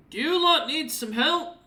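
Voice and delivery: high, loud friendly call down; Scartsmander's voice. Acted. Scartsmander calling down to the group, high and friendly. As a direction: call down to them, offering help. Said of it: "looks up with Blue behind him and goes".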